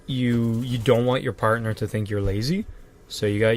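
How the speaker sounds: ordinary talk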